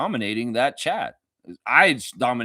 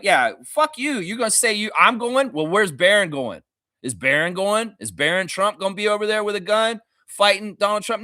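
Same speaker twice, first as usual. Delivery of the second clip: mock defiant rant; 8 semitones higher, 3 dB louder than usual. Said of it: "because it's like".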